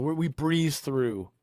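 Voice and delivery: usual speaking voice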